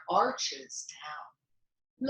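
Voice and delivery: usual speaking voice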